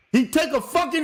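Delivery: ordinary talk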